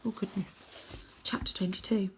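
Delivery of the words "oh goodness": soft whispered gasp of worry; hushed aside. Real, not acted. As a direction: breathe it out under your breath.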